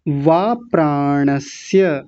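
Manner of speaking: वा प्राणस्य with a clear dental s in प्राणस्य, slowly; dental s demo. Shown as a demonstration. Teacher models प्राणस्य for the students, slow dental s. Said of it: "स, ये वाला स बोलना है।".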